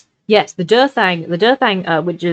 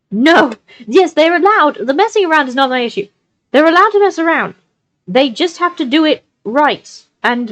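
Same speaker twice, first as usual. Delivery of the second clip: heated; 7 semitones higher, 3 dB louder than usual. Real, not acted. Defending point, raised pitch.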